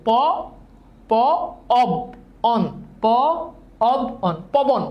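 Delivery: প, অব, অন each sounded alone, then joined as পবন; syllable breakdown. Shown as a demonstration. Teacher asking which sound it has, প, অব, অন said separately. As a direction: break পবন into প, অব, অন with clear pauses. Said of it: "নাকি আব? বলেন".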